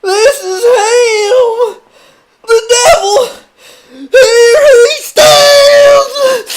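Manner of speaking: very high, loud, slow sobbing shriek. Acted. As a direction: wail it out in hysterical tears. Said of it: "cried the Reverend, sobbing".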